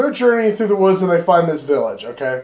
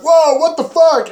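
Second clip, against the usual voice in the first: high, loud mock shock; whoa, what the fuck, thrown up high; shocked outcry; 11 semitones higher, 4 dB louder than usual. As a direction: yelp it out like you can't believe your eyes. Friends react to girl eating tapeworm.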